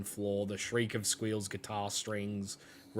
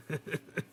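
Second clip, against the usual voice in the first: creepy chuckle; 6 semitones higher, 6 dB quieter than usual. Acted. Sinister creature chuckle.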